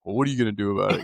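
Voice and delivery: fast, curt, mock-jerky question; jerky demo. Shown as a demonstration. Coach mocking her own curt question, fast and blunt. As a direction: rattle it off like a jerk. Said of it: "I sound like a jerk".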